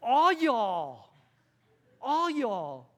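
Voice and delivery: loud, high All y'all with a heavy drawl; exaggerated Southern shout; hammed-up Southern. Shown as a demonstration. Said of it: "Somebody who's been living in the South".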